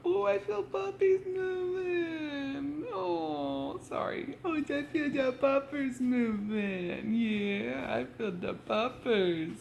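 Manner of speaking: high, slow, delighted voice; excited squeal. Real, not acted. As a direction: gush over the puppies. Feeling puppies move, excited.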